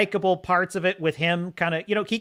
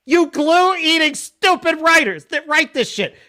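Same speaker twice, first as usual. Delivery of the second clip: exasperated rant; 9 semitones higher, 6 dB louder than usual. Real, not acted. Ranting at the writers.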